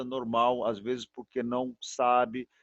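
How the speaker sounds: ordinary talk, nothing marked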